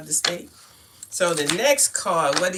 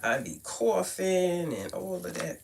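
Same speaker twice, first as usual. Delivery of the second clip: ordinary talk, nothing marked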